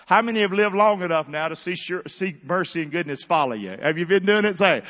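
ordinary talk

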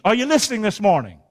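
usual speaking voice

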